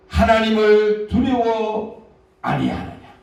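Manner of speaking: slow, loud stern rebuke; scolding rebuke. Acted. Thief scolding the mocking thief, slow and loud. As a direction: drag out each word like a scolding. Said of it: "막 꾸짖는 겁니다".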